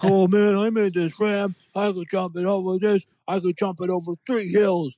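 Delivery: high put-on voice of an eager gamer; boastful player. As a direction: brag excitedly in a high, silly voice. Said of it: "everyone was trying to see how far".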